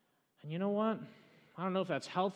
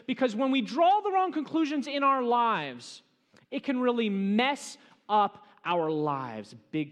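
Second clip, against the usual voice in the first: higher, louder warning from the pulpit; urgent preaching; 9 semitones higher, 8 dB louder than usual. Acted. Pastor cautioning the congregation, urgent preaching. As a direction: drive the warning home. Preacher warns with rising force.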